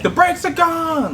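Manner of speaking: high, slow retold yell; yelled back. Friend in the front seat yelling back about the brakes, high slow yell. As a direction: shout it back like the car won't stop. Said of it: "he yelled back".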